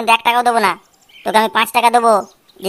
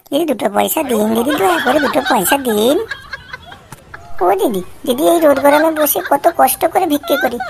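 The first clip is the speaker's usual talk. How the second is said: whining beggar; 3 semitones higher than usual. Acted. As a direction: wheedle coins from passers-by. Whining beggar recording from box.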